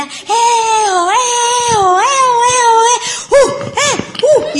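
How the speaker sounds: high, loud whooping chant; mock crowd cheering. Imitating the crowd standing on chairs, loud high whoops.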